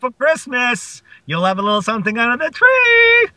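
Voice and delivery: high, hammed-up character voice; playful mock promise; put-on teasing voice.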